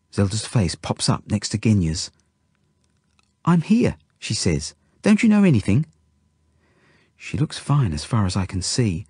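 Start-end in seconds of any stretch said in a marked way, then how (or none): 3.4-4.0 put-on high little-girl voice; Zelda. A narrator's reading. Zelda pops up by the bed, high child voice. 5.0-5.8 put-on high, indignant little-girl voice; Zelda. A narrator's reading. Zelda scolds the injured boy, high indignant child voice.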